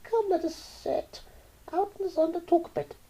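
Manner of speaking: put-on high, sweet old lady voice; old aunt. Aunt Dorothy invites Roy to sit in the sun. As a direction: coax like a fussy old aunt.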